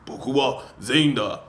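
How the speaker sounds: loud, hammed-up movie dubber; big stock voice-over voice, played for laughs; mock Japanese dub voice. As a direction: imitate that really deep dub voice. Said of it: "that really deep voice".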